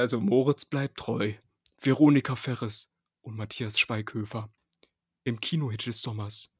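low, dramatic trailer announcer; movie trailer voice. Acted. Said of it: "Deine Trailer-Ansager-Stimme".

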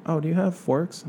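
high, breezy customer voice; entitled customer. Retold or imitated. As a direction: ask lightly as if it's nothing. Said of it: "And then".

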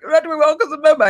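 high, rushed whiny wail; mock upset. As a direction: blurt it out high and fast, playing miserable. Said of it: "upset about the breakup".

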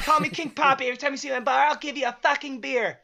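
King Poppy voice. Retold or imitated. Coworker imitating the self-named King Poppy, high and boastful. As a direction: swagger it like a college party king. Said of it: "That's how he talks".

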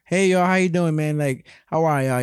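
hammy Southern twang on a hearty hello; bad Southern accent. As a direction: put on your worst Southern accent. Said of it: "This is my worst Southern accent".

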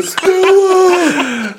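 Stella yell. Retold or imitated. Streetcar Stella yell quoted.

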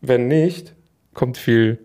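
ordinary talk, nothing marked

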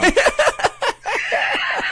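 bursting high cackle; loud high laugh. Real, not acted. Laughs at quick answer.

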